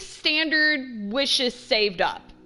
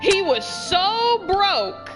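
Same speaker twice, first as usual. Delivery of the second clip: "so broke" drawn out slowly, pitched high; emphatic reminder; 7 semitones higher, much slower than usual. Pretend. Player arguing with the game companion about money, emphatic on "so broke". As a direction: stretch out "so broke" to drive it home.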